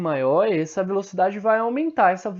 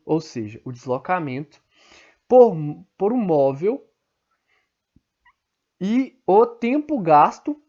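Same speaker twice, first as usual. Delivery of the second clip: over enunciating; 3 dB louder than usual. Shown as a demonstration. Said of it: "vou falar bem devagar pra vocês entenderem".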